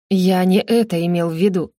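ordinary delivery

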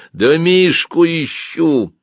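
high, drawn-out angry retort. Acted. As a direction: answer crossly, stretching the words high. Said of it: "рассерженно ответил тот". (рассерженно, высоким голосом)

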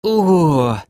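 high, drawn-out groaning "oh"; dazed, pained moan. A narrator's reading. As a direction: moan it out, stunned from a crash. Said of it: "На этот раз застонал Док".

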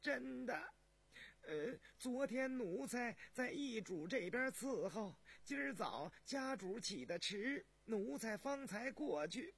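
high, slow, deferential explanation; high-pitched servant reporting; nervous palace servant. Acted. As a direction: explain yourself humbly in a high voice.

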